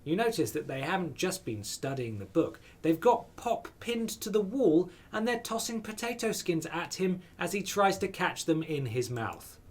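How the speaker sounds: normal talking voice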